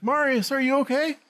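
high, anxious call to Marius; worried old woman. Retold or imitated. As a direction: voice her calling out in concern. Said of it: "she's like".

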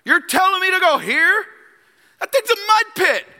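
high, loud indignant protest; mock-outraged commander, voice pitched high; outraged Naaman. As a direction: scoff at the muddy river like an offended general. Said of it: "Naaman sees the Jordan".

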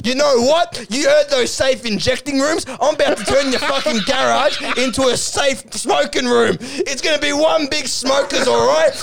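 high-pitched hammed-up tirade; mock threatening rant. Acted threatening rant in quarrel.

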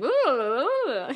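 swooping, exaggerated American-style oohs; mock expressive oohs. French professor mocking how Americans sound, swooping oohs. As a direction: ham up the singsong oohs. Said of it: "You guys are just so expressive".

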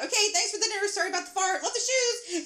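high, loud hurried farewell; frantic goodbye. A narrator's reading. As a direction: call out thanks as you flee. Hurried goodbye shouted while fleeing car.

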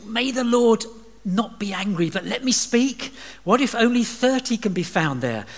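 a hint of a nervous Abraham; cautious plea. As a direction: tread carefully before God.

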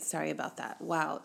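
ordinary talk, nothing marked

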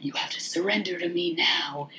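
hushed, menacing whisper; Candyman. Retold or imitated. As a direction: softly whisper it like a hypnotic villain. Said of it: "he's just like".

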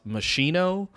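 MOSCHINO as muh-SHEE-no; common mispronunciation. Shown as a demonstration. Setting up a common mistake, MOSCHINO with SH. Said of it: "would think it's pronounced".

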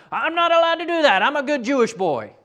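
imitating a Southern accent, jokey; mock southern accent. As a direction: ham up a Southern twang for a pious boy. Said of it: "'cause he had a southern accent".

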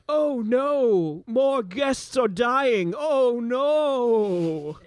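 high, drawn-out wailing dismay; mock dismay. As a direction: lament it high and slow, theatrically. GM voices Burzan's reply to alarm.

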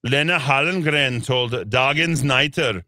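emphatic mock German accent. Newsreader hits Swedish names in a report, mock German accent. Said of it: "why I did German accent".

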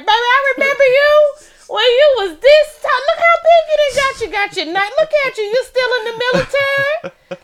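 put-on high, loud gushing old relative; hammed-up doting elder back home; old hometown relative. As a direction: gush over someone not seen in years. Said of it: "and they like".

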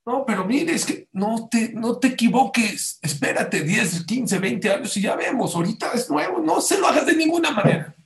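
high, insistent plea that climbs to a loud finish; emphatic warning. Retold or imitated. Second paediatrician says wait some years, emphatic warning. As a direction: implore them, ending high and loud. Paediatrician warns against the injections.

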